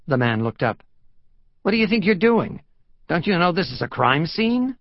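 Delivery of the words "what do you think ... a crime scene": high-pitched, louder police reprimand; exasperated cop confronting an intruder; indignant challenge. Acted. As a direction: challenge a trespasser in a raised pitch. Sergeant challenges trespasser.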